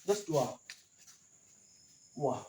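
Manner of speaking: ordinary delivery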